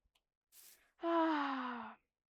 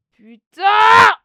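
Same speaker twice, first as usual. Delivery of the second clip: sudden furious yell; frustrated gamer screaming a curse; angry shout; 8 semitones higher, 23 dB louder than usual. Real, not acted. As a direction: blurt it out at full volume. Rage outburst while playing.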